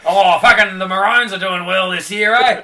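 high, very loud broad Australian bellow; hammed-up Australian accent. Topping his friend's joke about feeling Australian, hammed-up Aussie accent.

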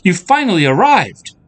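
high, loud princess call. Acted. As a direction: call joyfully across the meadow. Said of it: "came a voice from behind her".

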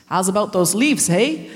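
a light Canadian accent, imitated. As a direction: mimic Canadian elders. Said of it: "managed to make this accent".

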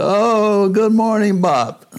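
put-on loud, high-pitched Cuban-accented greeting. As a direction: imitate a Cuban doctor's effusive hello. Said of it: "in his Cuban, uh, accent".

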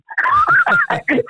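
bright burst of real laughter; a real laugh breaking through the story. Real, not acted. Laughing at her own joke.

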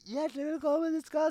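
high, loud host's welcome; loud welcome shout. Retold or imitated. Co-host imitates her over-loud show opening, loud mock shout. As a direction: belt out the greeting high and loud. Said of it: "så jeg tenkte at den var høy".

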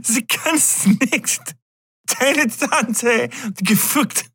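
halting, high, slow German with a laugh under it; broken German. Pretend. Joking to viewers in clumsy German, high and slow. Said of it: "I can't remember how to say fucked right".

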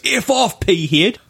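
retold rude outburst, high; angry retort. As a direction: imitate the guy snapping back. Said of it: "then this guy goes".

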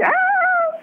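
playful high-pitched ouch; retold scream. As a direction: yelp Ah like a bee just stung you. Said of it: "I started screaming".

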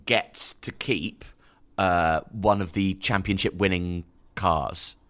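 ordinary talk

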